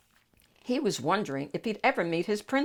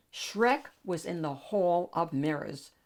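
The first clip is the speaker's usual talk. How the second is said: plain reading voice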